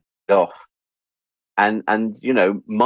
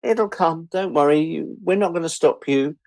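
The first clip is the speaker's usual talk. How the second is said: breezy dismissive; 8 semitones higher than usual. Retold or imitated. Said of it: "And the B B C were like".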